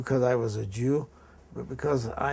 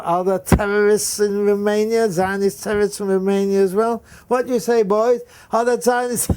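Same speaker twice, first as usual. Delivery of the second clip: much higher and louder, goading the class; sneering teacher; 8 semitones higher, 8 dB louder than usual. Retold or imitated. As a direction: whip up the boys against him. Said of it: "he would say".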